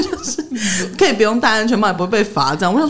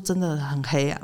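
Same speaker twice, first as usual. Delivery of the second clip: hushed aside; 4 semitones lower, 8 dB quieter, slower than usual. Retold or imitated. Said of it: "她就很小声的跟我讲说".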